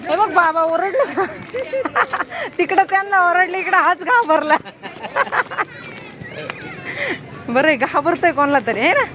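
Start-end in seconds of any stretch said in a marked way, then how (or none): 1.3-2.5 bright high-pitched burst of laughter. Real, not acted. Mother laughing at her scared son, high laugh. 4.6-7.4 drawn-out high-pitched laughter, fading; long high laugh. Real, not acted. Mother laughing at how scared her son got, long high laugh.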